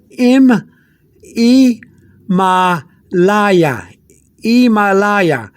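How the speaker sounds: "Himalaya" said slowly as ee-mah-LAH-yah; Spanish "Himalaya" starting on a long "ee", no H sound; silent H. Shown as a demonstration. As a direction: drop the H in "Himalaya", drawn out syllable by syllable. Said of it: "you don't pronounce the H".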